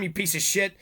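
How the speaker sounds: usual speaking voice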